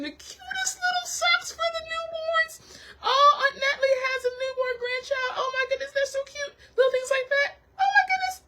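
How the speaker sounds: real gush, voice cracking up high; teary high voice. Real, not acted. To viewers about Aunt Natalie's newborn grandchild, tearful high squeal. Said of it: "high voice".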